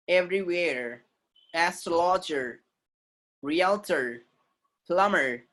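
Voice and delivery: everywhere, astrologer, realtor, plumber with final R sounded; American voiced R. Shown as a demonstration. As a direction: hold the R ending of each word in everywhere, astrologer, realtor, plumber. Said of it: "do not keep that R silent".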